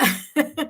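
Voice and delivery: brief leftover laugh; short chuckle. Real, not acted. Reader apologising for a New York accent, chuckling. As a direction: chuckle before getting back to the reading. Still amused after accent remark.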